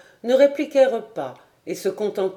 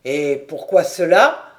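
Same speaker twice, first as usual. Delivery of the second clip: louder, lower, firm question; lieutenant's challenge; 4 semitones lower, 7 dB louder than usual. A narrator's reading. Lieutenant challenges the sergeant, low and firm. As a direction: press him with a firm question.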